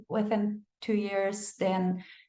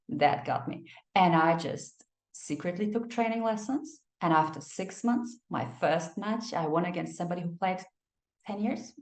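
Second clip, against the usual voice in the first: ordinary talk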